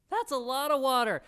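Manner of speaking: loud, high, incredulous emphasis; amazed exclamation. Real, not acted. Preacher compares the miracle with his family's water use, amazed. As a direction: pitch it up and marvel loudly. Marvels, compares with own household.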